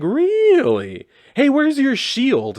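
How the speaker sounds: high mock-surprised jab at the shield. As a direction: squeak it out like a cocky kid. Mocking reply to US Agent.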